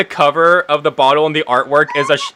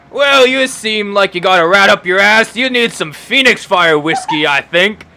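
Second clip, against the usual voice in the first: loud, higher rowdy barkeep voice; gruff bartender; 7 semitones higher, 5 dB louder than usual. Acted. Bartender teasing the sulky newcomer Beck, loud and rowdy. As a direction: needle him like a pub landlord. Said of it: "Beck, and says".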